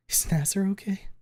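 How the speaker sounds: high, whispered frightened question; fearful whisper. Acted. Fang asks her friend whether Nasser is alive, in a frightened whisper. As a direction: whisper it, scared of the answer.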